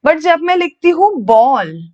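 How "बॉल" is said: teacher demonstrating बॉल; model word ball. Shown as a demonstration. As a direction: draw out बॉल to show the "o" sound. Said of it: "बॉल में ए को तो ओ pronounce कर रही हूँ".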